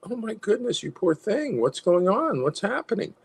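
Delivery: high, cooing sympathy; nurturing concern. Acted. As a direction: fuss over someone, acted. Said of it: "a desire to nurture that".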